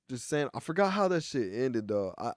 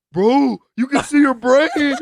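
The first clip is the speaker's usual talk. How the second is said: loud, high, drawn-out alarm; hammed-up horrified exclamation; mock shocked cousin; 8 semitones higher, 10 dB louder, slower than usual. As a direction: imitate an astonished onlooker, half laughing. Retells cousin's shocked reaction.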